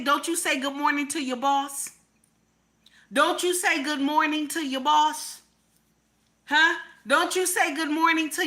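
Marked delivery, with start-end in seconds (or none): none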